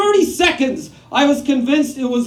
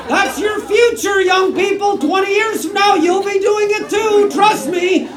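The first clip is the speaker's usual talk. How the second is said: mock warning shout; 6 semitones higher than usual. Shouting warning at young audience.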